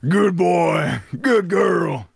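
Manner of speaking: high, loud Southern cowboy voice; mock Western outlaw calling out; imitating Southern cowboy. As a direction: ham up an Arthur Morgan drawl. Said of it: "Now everybody's going".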